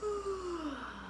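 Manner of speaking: long soft falling yawn sigh; big demo yawn. Shown as a demonstration. Teacher showing children a big yawn, long sighing yawn. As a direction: yawn it out wide for the kids. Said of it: "Can you yawn?".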